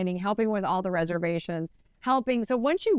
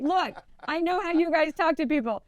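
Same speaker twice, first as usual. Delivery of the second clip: raised-pitch, insistent retold speech; acted firm insistence; 6 semitones higher than usual. Agent sets a boundary with client's mean friends, acted insistence. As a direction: lay down the rule, pitched up.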